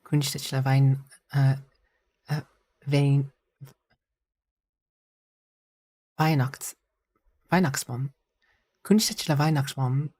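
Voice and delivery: halting, clumsy German accent; scuffy German accent. Shown as a demonstration. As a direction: sound it out in bad German. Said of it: "scuffiest German accent ever".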